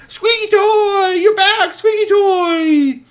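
very high, loud, drawn-out squeal; long, stretched child's cries of delight; overjoyed cry. Acted. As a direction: shriek with joy at a reunion. Child greets returned lost toy.

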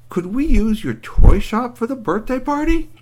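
eager plea. Acted. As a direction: beg sweetly, voice lifting high. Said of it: "she said".

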